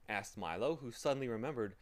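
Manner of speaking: ordinary delivery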